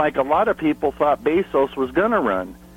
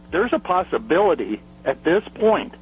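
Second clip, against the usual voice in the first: ordinary talk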